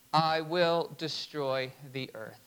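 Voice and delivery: low, slow divine decree; a somber God, drawn out; grave voice of God. Acted. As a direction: pronounce the sentence low and heavy. Quoting God's decree.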